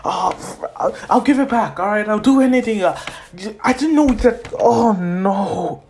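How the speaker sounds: high, flustered, stammering pleading; guilty panic. Acted. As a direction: plead frantically to make amends.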